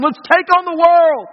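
rally the troops to charge into death; Braveheart rallying cry. Retold or imitated. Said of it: "One is the Braveheart way".

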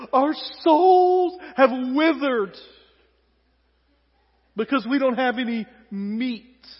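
slow, loud, overwrought wail; melodramatic lament. Acted. Israelites lamenting to Moses that their souls withered, melodramatic and loud. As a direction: play the tragedy queen, slowly. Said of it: "A little melodramatic".

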